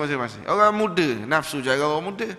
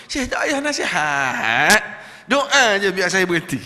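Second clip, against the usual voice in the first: slow, louder, pressing request; insistent plea; 5 semitones higher, 8 dB louder than usual. Retold or imitated. Smoker insisting the Syekh just pray, slow and loud. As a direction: lean on it and insist.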